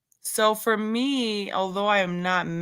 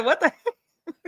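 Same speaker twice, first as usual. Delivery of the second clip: laughing in disbelief; 10 semitones higher than usual. Retold or imitated. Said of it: "And I said".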